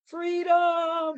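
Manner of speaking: high, loud battle cry of freedom; Braveheart yell. Retold or imitated. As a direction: shout it like a warrior charging. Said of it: "he yelled out".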